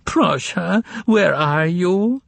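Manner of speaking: high, slow, soft plea, tearful; soft tearful cry. A narrator's reading. Secretary calling for her vanished boss, soft and tearful. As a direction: call out for Prosha through tears, softly. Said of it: "in a soft, musical voice that was slightly nasal-sounding from so much crying".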